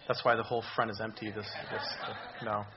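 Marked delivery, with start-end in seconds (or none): none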